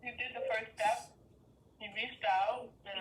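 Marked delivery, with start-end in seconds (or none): none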